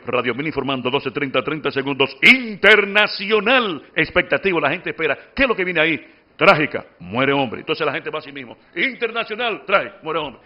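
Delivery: low, halting news read with long breaks; broken reading demo. Shown as a demonstration. Teacher demonstrating a choppy radio news read to the class, broken delivery. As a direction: chop the headline into fragments like a radio bulletin. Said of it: "en el estilo de lectura quebrada".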